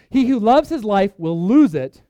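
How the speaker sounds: slow, loud emphatic verse; proclaimed scripture; preaching loud. Acted. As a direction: declare it to the room. Preacher reads verse with force.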